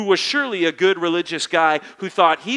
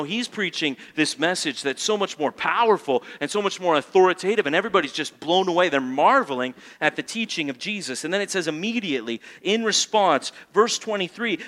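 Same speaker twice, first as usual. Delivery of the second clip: ordinary delivery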